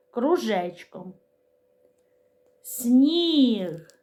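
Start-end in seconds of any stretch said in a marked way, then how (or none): 2.6-3.9 "Сніг" drawn out to a clear final г; slow teaching "Сніг", finishing on an audible г; sounding out. Shown as a demonstration. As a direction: stretch "Сніг" and sound its last consonant distinctly. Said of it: "Звук г".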